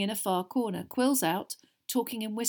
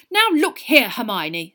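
indignant; 3 semitones higher, 11 dB louder, slower than usual. A narrator's reading. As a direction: cut in with pompous reproof. Percy's heated argument with Hermione.

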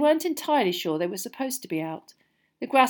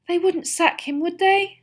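high, louder worried question; anxious; 12 semitones higher, 8 dB louder, slower than usual. A narrator's reading. Hermione fears for Hagrid's job, anxious. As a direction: plead for reassurance, pitch well up. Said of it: "said Hermione anxiously".

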